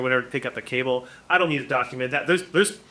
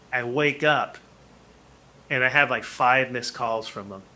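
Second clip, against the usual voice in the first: ordinary delivery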